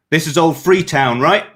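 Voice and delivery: calling loud up to a tower; shouted up. A narrator's reading. Cleanser shouting up to the gate guard tower, loud call. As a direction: raise the voice to reach the guards. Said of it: "shouted up to the guard tower".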